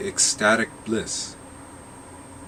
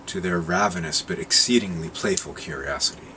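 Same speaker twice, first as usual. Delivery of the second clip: plain reading voice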